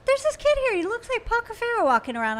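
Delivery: high, loud imitation of an earnest friend; serious, earnest impression. Imitating Joanna spotting a lookalike kid, high and in earnest. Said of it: "she was so serious. She was like".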